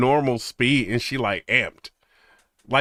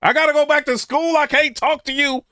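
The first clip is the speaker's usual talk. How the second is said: flustered excuse; 10 semitones higher than usual. Retold or imitated. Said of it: "You ain't gonna know what to say".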